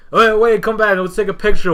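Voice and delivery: high, loud and fast, calling him back; eager calling back. Retold or imitated. Everyone after the fight calling him back, eager and fast. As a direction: hurry him back for a photo. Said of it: "And everyone was like".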